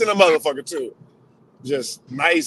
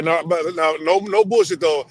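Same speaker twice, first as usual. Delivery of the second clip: ordinary talk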